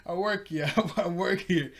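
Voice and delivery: lightly acted "hey, hold on" objection; mock protest. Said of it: "everybody starts to yell at him".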